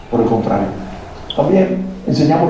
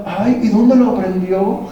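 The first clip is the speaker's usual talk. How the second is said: feigned innocence; 7 semitones higher, slower than usual. Retold or imitated. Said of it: "así como que nos damos por enterados".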